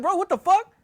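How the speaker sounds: high-pitched, rattled disbelief; acted freak-out. He hears something grabbed her ankle, acted freak-out. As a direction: shoot the voice up in alarm. His stunned reaction to her grab.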